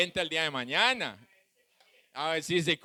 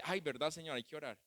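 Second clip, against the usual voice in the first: much softer, lower, caught-out admission; softer, lower "oh right, Lord"; sheepish realization; 4 semitones lower, 12 dB quieter, faster than usual. Retold or imitated. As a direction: mumble it like someone caught forgetting. Wife voiced, sheepishly remembering.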